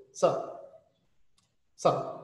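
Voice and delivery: "Sup" as one tiny syllable; very clipped. Retold or imitated. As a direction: cut "what's up" down to a quick "Sup". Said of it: "ela fala muito rápido".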